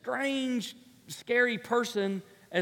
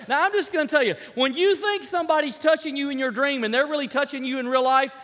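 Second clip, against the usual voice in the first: ordinary delivery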